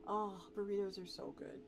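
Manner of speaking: ordinary delivery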